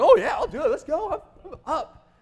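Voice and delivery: high-pitched, over-keen yes-man; fake eagerness. Hiding resentment from the missionary, overdone fake eagerness. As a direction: leap in with put-on enthusiasm, pitch way up. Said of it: "I didn't say that. I was like".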